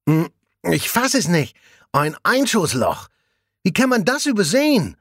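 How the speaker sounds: outraged while still chewing; indignant, mouth full. A narrator's reading. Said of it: "empört sich Jasper nun mit vollen Backen". (empört mit vollem Mund)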